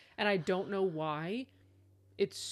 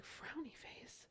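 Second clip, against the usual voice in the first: whispered "Frowny face?", very slow and soft; puzzled echo; 8 semitones higher, 16 dB quieter, much slower than usual. Retold or imitated. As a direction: echo the nickname in quiet disbelief. Husband puzzled by nickname.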